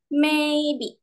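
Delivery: maybe. pitched high and held level; teacher models tone one; first tone. Shown as a demonstration. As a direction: keep maybe. high and flat. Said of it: "Yang pertama".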